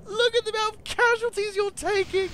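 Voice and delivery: loud, high gleeful laughter; laughing loudly. Real, not acted. Gamer laughing at the enemy's huge losses. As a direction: crack up at the carnage.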